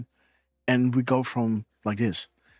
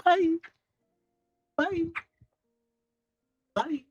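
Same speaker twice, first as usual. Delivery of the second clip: singsong bye; 13 semitones higher, slower than usual. Real, not acted. Singsong goodbye to child.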